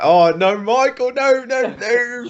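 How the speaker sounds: high, loud whiny protest; mock whiny Jack Fowler. Jack Fowler pleading with Michael, high and whiny. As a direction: squeal like a scolded posh boy. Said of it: "Jack Fowler's there like".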